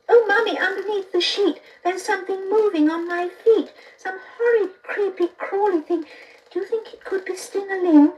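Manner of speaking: put-on a child begs mummy about something under the sheet; scared child. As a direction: whine it like a scared kid in bed.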